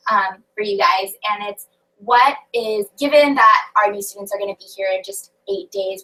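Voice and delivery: ordinary talk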